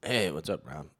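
a hint of a laid-back bro saying hi; mild mock hello; Brendan impression. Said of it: "nail a Brendan Cooney impression".

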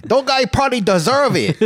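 put-on high caricatured Chinese accent; hammed-up high Chinese accent; mock Chinese accent. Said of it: "in a Chinese accent".

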